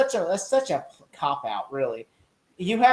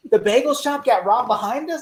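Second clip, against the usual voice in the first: high-pitched mock-astonished cry; Clark; 8 semitones higher than usual. Retelling his reaction to the bagel robbery, incredulous. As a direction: imitate stunned disbelief, voice pitched way up. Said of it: "when Clark says".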